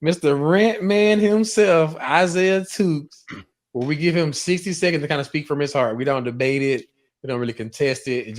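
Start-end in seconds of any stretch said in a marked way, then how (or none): 0.0-3.2 slow, raised hype-man intro; big drawn-out segment introduction; announcer introduction. Real, not acted. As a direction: stretch out the name like a ring announcer. Host introduces the rant segment.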